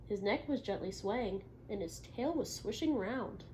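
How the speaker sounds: picture-book read-aloud; reading to children. Acted. Teacher reading a story to the class.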